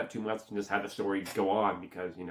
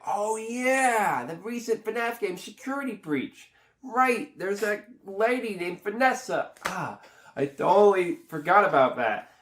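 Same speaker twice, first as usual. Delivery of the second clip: high, drawn-out dawning realisation; mock-amazed "oh right" voice; sudden recollection; 8 semitones higher than usual. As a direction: replay the moment it all comes back. Re-enacts suddenly remembering the game.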